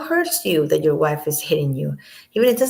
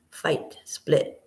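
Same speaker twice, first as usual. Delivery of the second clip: whispered; 6 dB quieter than usual. Retold or imitated. Mocks her repeated words.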